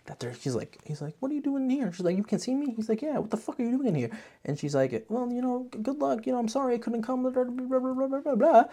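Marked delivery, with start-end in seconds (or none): 1.2-1.9 mock surprised fighter. 2.2-4.1 mock high character voices. Both characters voiced in turn. 5.1-8.6 mock high girlish babble. Voicing the girl's rushed apology.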